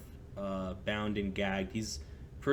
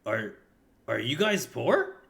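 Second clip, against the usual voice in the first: NPC voice; 4 semitones higher, 7 dB louder, slower than usual. Acted. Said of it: "he's like".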